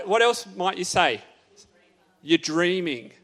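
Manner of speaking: ordinary talk, nothing marked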